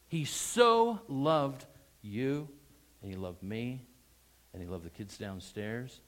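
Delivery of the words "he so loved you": long, loud "so"; stress on so. Shown as a demonstration. Preacher turning the verse on the listener, heavy stress on "so". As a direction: draw "so" out slowly with force. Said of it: "emphasize so in your mind".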